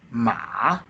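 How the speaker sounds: long dip-and-rise "ma"; third tone. Shown as a demonstration. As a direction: drop "ma" down and bring it back up. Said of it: "the third tone is".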